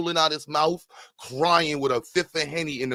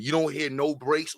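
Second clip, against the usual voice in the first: ordinary delivery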